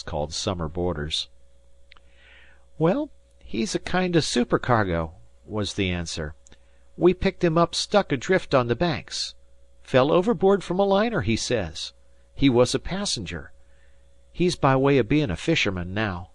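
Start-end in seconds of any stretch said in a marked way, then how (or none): 2.8-5.1 put-on high-pitched fisher boy; a lad chatting to a stranger; young Dan. Narrator voices young Dan. 7.0-16.3 put-on high-pitched fisher boy; a lad chatting to a stranger; young Dan. Narrator voices young Dan.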